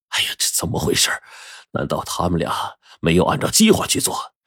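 low, soft talking to himself; puzzled muttering. Acted. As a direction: mutter as you try to work it out. 徐总自言自语地琢磨. (低声自言自语，满心疑惑)

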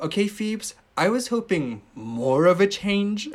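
high, slow dry Rachel voice; deadpan correction, voiced up high; lightly mimicked sarcasm; quoting Rachel. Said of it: "She said".

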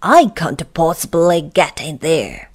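cross protest; irritable refusal; snapping. Acted. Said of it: "snapped Mister Uppity".

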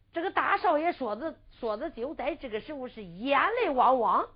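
ordinary delivery, nothing marked

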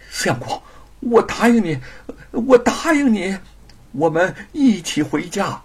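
high, urgent agreement; wife giving in. Acted. Wife yields to husband's threat, urgent high pleading. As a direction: give in fast to stop him. 玉凤 gives in.

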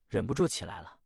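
plain reading voice

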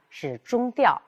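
ordinary talk, nothing marked

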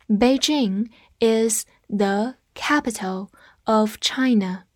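word by word, slowly, for learners to repeat; slow read-along. Shown as a demonstration. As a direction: read it slowly with a gap after each word. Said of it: "跟着我慢读一遍". (慢速跟读，一词一顿)

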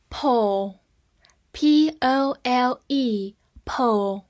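letters one by one, very slow; spelled out slowly. Shown as a demonstration. Teacher spelling the word for students, slowly. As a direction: spell each letter carefully. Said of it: "spelling out letters".

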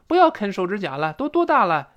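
high, exasperated nag; lightly acted mum's reproach; scolding mother. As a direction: chide the child, pitch jumping up. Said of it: "比如妈妈对儿子说".

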